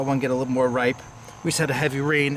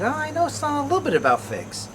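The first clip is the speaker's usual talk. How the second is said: high-pitched, modest protest; coy retold reply; 13 semitones higher than usual. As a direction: start way up high, then drop back down. Retelling his own coy reply.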